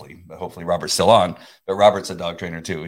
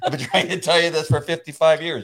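high, louder, laughing praise; amused, raised-pitch pat on the head; joking praise; 7 semitones higher, 4 dB louder than usual. Acted. Voices God praising him, joking.

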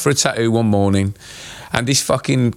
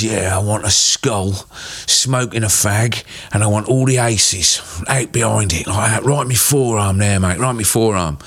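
laboured, boozy demand; drunk geezer. Retold or imitated. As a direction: imitate a big drunk bloke placing his order. Drunk huge customer ordering tattoo.

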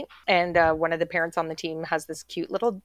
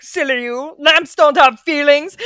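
high, loud, slow mock ad voice; hammed-up commercial spokesman; ikea guy; 8 semitones higher, 10 dB louder, slower than usual. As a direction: talk down to sentimental viewers. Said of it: "the ikea guy is like".

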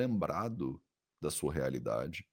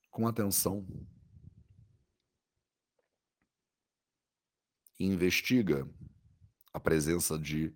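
soothing guided meditation. Acted. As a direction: guide the body scan gently, with long pauses.

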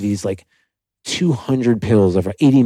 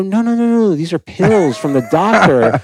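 higher, louder, insistent denial; defensive protest; 9 semitones higher, 4 dB louder than usual. Retold or imitated. Denying addiction to his mom, a higher, louder insistent protest. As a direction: brush off mom's worry. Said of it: "I go".

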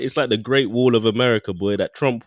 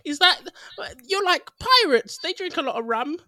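put-on European holiday accent. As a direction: ham up a clueless European tourist. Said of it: "that's my European on holiday accent".

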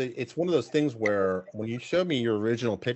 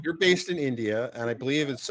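ordinary delivery

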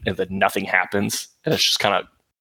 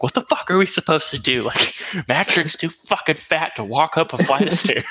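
Gabe freaking out; 8 semitones higher than usual. Retold or imitated. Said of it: "He's like".

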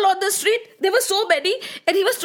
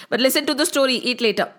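ordinary delivery

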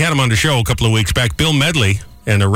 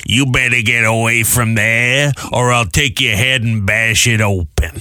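mean grandma voice; slower than usual. Retold or imitated. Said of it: "she was doing the".

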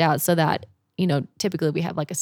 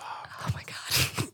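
slow, voiceless whisper; whispered dread; 11 dB quieter, much slower than usual. Retold or imitated. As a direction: breathe it out in hushed alarm. Re-enacts dread as bear walks between tents.

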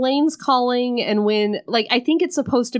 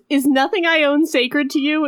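mock heroine, pitched up; 7 semitones higher than usual. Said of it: "She goes".

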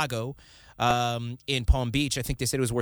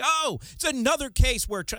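much higher, whiny mock outrage; mocking liberal viewer; 11 semitones higher than usual. Host mocking liberal viewers at home, much higher whiny voice. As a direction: mimic a smug viewer who has heard it all. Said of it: "you guys at home are like".

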